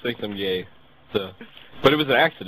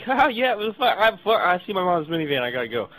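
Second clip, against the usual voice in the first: mock goofy kid voice, pitched higher, trailing down at the end; imitating Corey; 6 semitones higher than usual. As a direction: play the clueless guitar kid brushing it off. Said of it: "He's like".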